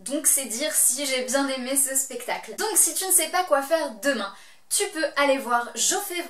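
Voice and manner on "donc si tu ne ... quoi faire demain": usual speaking voice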